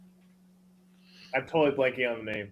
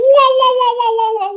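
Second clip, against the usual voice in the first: normal talking voice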